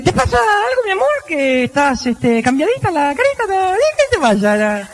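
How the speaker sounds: high sugary pleading; sweet-talking husband. Acted. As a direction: coax her like a worried husband. Husband sweet-talking upset wife.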